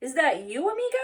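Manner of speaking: excited greeting. Acted. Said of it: "exclaimed Scapito".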